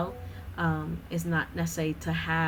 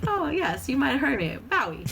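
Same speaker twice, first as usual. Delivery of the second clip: high, grand demigod voice; boastful hero from Moana; Maui impression; 7 semitones higher than usual. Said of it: "he's like".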